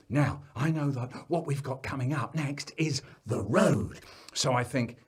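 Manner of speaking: plain reading voice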